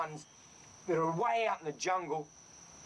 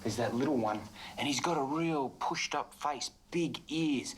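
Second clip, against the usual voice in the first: ordinary talk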